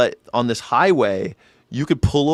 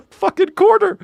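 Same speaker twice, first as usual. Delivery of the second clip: amazed outburst; 6 dB louder than usual. Real, not acted. Laughing at old prices.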